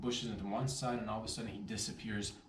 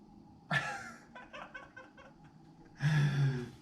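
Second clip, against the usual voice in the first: high loud laugh; high burst of laughter; big laugh; 5 dB louder than usual. Real, not acted. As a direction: laugh at your own boast. Proud of himself.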